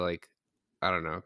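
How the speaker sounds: ordinary talk, nothing marked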